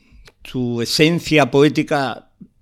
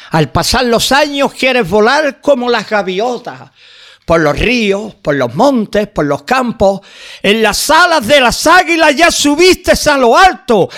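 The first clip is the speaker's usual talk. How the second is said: raised, ringing voice reading his verses, some lines slowed; poem recitation; 7 semitones higher, 4 dB louder than usual. Acted. Reciting his own poem to the radio listeners, declaimed with feeling.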